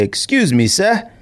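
mock English accent. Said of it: "I use my English accent".

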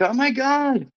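put-on high, gushing swoon of a girl at a bar; swooning fangirl. A girl at a bar gushing over the accent, high squeal. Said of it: "just based on the accent".

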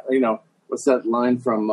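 usual speaking voice

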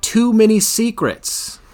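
high, drawn-out quoted catchphrase; hammed-up movie quote. Host quoting the film as his excuse, hammed up. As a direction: draw the line out high and slow.

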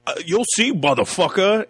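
high, strained mock threat; bad threat imitation. Imitating the husband threatening Mark, mock menace. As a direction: ham up a clumsy tough-guy threat. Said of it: "the way he says it, it's bad".

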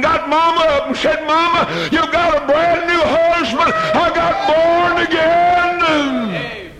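preacher crying out his father's conversion; preaching at full force. Acted. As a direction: lift it into a ringing sermon climax. Preaching climax, rising fervor.

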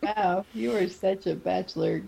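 normal talking voice

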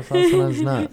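indignant high squeak; squeaky. Real, not acted.

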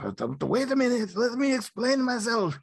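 hammed-up nervous Cassian excuse; flustered Cassian. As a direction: squeak it out like a panicky wimp. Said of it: "And he's like".

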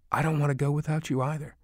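a touch of grief held in; understated tearful mother. A narrator's reading. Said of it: "tears streaming down her face".

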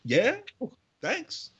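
high, pleasantly surprised; surprised thanks. Retold or imitated. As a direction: act caught off guard. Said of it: "So I was like".

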